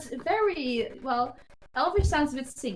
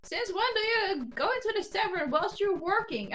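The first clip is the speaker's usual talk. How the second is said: high-pitched, incredulous barmaid voice; acted barmaid, pitched up in disbelief; questioning barmaid; 7 semitones higher than usual. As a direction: question her as a puzzled barmaid, voice lifted. Barmaid questions Jasmine at tavern.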